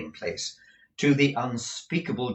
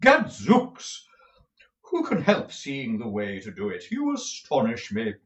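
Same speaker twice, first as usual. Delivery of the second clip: pompous tragedian; 3 semitones higher, 6 dB louder than usual. A narrator's reading. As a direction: roll it out like a stage tragedian. Lenville's tragedian voice.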